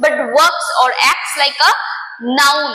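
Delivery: slow, deliberate dictation; slow definition. Shown as a demonstration. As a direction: spell the rule out slowly for students. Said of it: "জিরান্ড হচ্ছে দেখতে এটাকে ভার্বের মতো".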